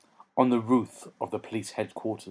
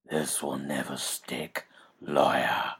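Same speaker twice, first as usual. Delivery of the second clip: low, slow whispered snarl; Batman's menacing rasp; 10 semitones lower, 4 dB quieter, slower than usual. Acted. Batman growls down at the lawyer in a low whispered snarl. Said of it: "Batman sneered".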